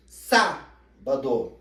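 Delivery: SÁBADO with a loud SÁ, then a pause before bado; very slow Sábado, punching SÁ; stressed syllable split off. Shown as a demonstration. Teacher showing the tonic syllable, Sábado loud and broken after SÁ. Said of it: "identificar qual é a sílaba tônica".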